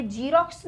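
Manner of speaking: "ज़ीरॉक्स" starting with j, as jeerox; j instead of z. Shown as a demonstration. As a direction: slip into a j for "ज़ीरॉक्स". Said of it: "मेरे मुंह से भी गलत pronounce". ("ज़ीरॉक्स" को ज से जीरॉक्स)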